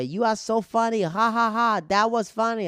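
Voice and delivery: high, loud mock praise with forced ha-ha; pushy, high-pitched heckler yell; aggressive heckling. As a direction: imitate a heckler shouting compliments aggressively. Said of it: "in an, an aggressive way".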